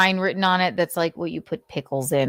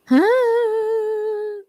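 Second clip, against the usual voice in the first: high sigh. Real, not acted. Exasperated sigh.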